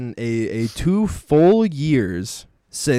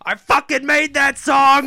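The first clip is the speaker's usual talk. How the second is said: angry yell; 13 semitones higher, 6 dB louder, slower than usual. Retold or imitated. Acted angry yell at diners.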